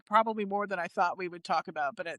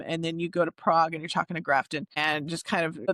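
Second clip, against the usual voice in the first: normal talking voice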